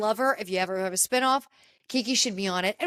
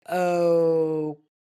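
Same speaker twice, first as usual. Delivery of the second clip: low, loud, drawn-out mock okay; dubious okay; 6 semitones lower, 5 dB louder than usual. Friends react to her claim she isn't single, dubious. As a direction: imitate a sceptical reaction, stretched out slowly. Everyone's doubtful reaction, acted out.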